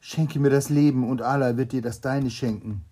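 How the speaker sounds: loud, desperate plea for life; pleading cry. Acted. Doctor begging the king for mercy, loud and desperate. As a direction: cry out to the king to spare you. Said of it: "Der Arzt aber rief".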